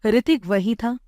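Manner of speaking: plain reading voice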